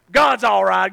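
ordinary talk

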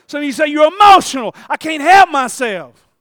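high, shouted sermon fervour; full-force preaching. Acted. Preacher answering those who question his worship, full-force preaching. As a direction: shout it out with everything you have.

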